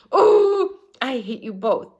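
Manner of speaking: high, loud cry of hate; girl stamping her foot and shouting; frustrated outburst. A narrator's reading.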